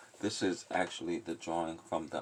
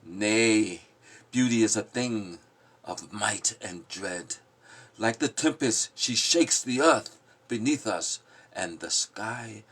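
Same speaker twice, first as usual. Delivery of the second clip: louder, declaiming the lines; forceful poem reading; 5 semitones higher, 9 dB louder, slower than usual. Acted. Giving voice to the passionate in the poem, loud declaiming. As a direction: proclaim it like a storm.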